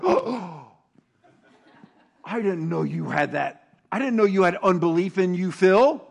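comic scandalized surprise, rising to a loud cry; mock shock. God pretends to be shocked by the pastor's unbelief. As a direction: play it as a fake dramatic discovery. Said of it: "He's not like".

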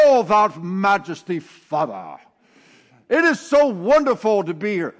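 hammed-up lofty prayer voice; mock pompous prayer. A believer prays to God in an overblown, stagey voice. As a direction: overdo the holy tone like a bad actor. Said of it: "you come to him in prayer in the morning and say".

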